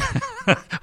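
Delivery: a quick real laugh that rises high; short high laugh. Real, not acted. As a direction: burst out laughing at the question. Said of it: "what are you laughing about?".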